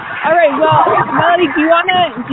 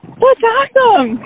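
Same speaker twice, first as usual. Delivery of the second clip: excited; 8 semitones higher, slower than usual. Real, not acted. Host reacting to creative goals.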